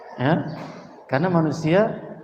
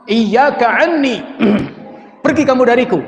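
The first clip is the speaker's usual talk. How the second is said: loud, higher, sharp scolding; harsh rebuke; 7 semitones higher, 3 dB louder than usual. Retold or imitated. Grieving mother at the grave rebuking a stranger, loud and angry. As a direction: drive him away with a loud snap.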